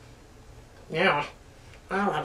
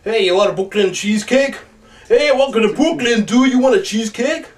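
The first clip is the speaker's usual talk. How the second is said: put-on Brooklyn accent; 5 dB louder than usual. Mocking Bob Hoskins doing Americans, hammed-up Brooklyn accent. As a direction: ham up a New York Brooklyn accent. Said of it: "when he does Americans, he always does that".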